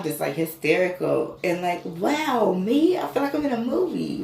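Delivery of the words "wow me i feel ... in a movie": high, louder amazed wow; disbelieving joy, almost crying out; feeling chosen like in a movie; overwhelmed wonder. Retold or imitated. Retelling awe, feeling chosen.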